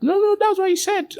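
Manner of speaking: high-pitched insistent comeback; insistent retort. Acted. Debater pressing an opponent on their words, insistent retort. Acted argument, insisting back.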